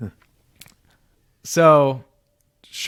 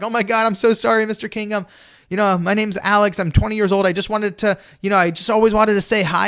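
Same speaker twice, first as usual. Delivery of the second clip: flustered; 7 semitones higher than usual. Retold or imitated. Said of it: "I'm like".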